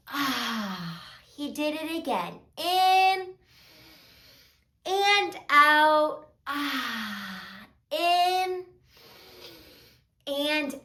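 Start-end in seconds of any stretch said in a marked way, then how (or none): 0.1-1.0 soft open-mouthed breath out; long exhale. Shown as a demonstration. Exhale demonstrated in the story. 6.5-7.7 soft open-mouthed breath out; long exhale. Shown as a demonstration. Exhale demonstrated in the story.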